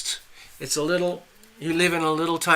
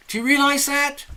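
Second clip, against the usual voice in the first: much higher, insistent question; incredulous urging; 11 semitones higher, 4 dB louder than usual. Acted. Stranger pushing fisherman to catch more, incredulous. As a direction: press the point in a raised pitch.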